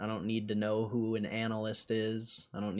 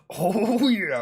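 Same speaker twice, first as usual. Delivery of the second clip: high, loud wrestler cry; Macho Man voice; 11 semitones higher, 8 dB louder than usual. Retold or imitated. Streamer recalls his wrestler impression, loud and high. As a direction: belt it like a wrestling promo. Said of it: "do the Macho Man voice".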